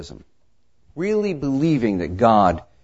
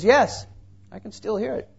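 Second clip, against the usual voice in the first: straining listener; 10 semitones higher than usual. Retold or imitated. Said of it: "Jed listened very carefully".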